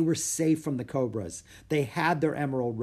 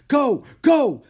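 loud, high, panicked "Go! Go!"; boy urging his sister to run, loud and frantic; sputtered urging; 12 semitones higher, 12 dB louder than usual. Acted. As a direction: spit the words out in a rush to flee. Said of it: "sputtered Jack".

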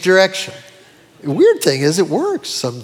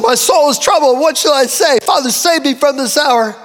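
higher, pleading, torn voice; anguished plea; 6 semitones higher than usual. Acted. Preacher retelling Jesus' troubled cry, higher pleading anguish. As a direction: cry out the words in distress. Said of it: "all of a sudden".

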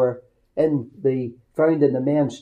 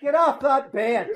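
high, sudden Northern Irish shout; mock-sweet Northern Irish yell; 8 semitones higher than usual. Father to young son bouncing on a shop bed, comic Northern Irish yell. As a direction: bellow it like a cross Northern Irish dad. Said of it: "in my sweet Northern Ireland accent".

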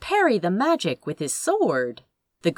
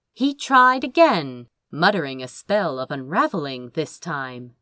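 ordinary delivery, nothing marked

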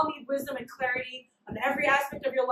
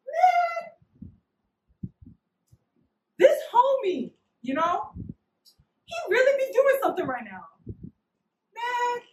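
incredulous; 10 semitones higher, slower than usual. Retold or imitated. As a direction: squeal it in disbelief. Dissatisfied after pastor's advice.

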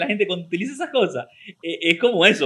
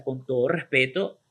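lowered, ceremonious insult; mock-respectful; 6 semitones lower than usual. Shown as a demonstration. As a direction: deliver the insult as a solemn courtesy. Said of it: "Manito en el pecho y pico parado". (con falso respeto, solemne)

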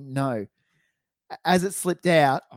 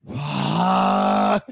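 low, dragged-out dejected curse; deep defeated groan. Retold or imitated. The mate with the lost key, groaning in defeat. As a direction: sound utterly defeated, shoulders slumped. Imitates slumped friend swearing.

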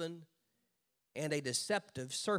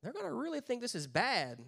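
doubtful writers; 6 semitones higher, 5 dB quieter than usual. Retold or imitated. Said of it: "And we're like".

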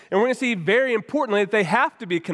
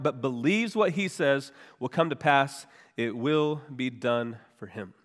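scripture reading; 6 semitones lower than usual. Acted. Reads the scripture passage aloud.

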